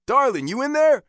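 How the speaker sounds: loud, high yell through a door; calling out. A narrator's reading. Biker pounding on girlfriend's door, calling out loudly. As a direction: call to someone inside.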